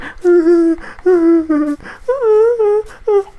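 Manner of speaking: put-on very high squeal of a nervous kid; squeaky high voice. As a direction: squeak like your younger self scared in a canoe. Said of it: "It was a little bit higher".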